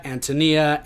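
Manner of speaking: Antonia as an-tuh-NEE-uh; variant pronunciation. Shown as a demonstration. Lecturer listing heard variants, Antonia pronounced an-tuh-NEE-uh. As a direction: say Antonia with a short second vowel and NEE stressed. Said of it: "I've heard her name pronounced differently".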